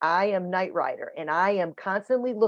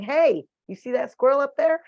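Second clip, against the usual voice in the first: high, eager voice for the dog; excited dog; 8 semitones higher, 4 dB louder than usual. Acted. Dog alerting her handler to a squirrel, excited. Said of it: "'cause she's excited".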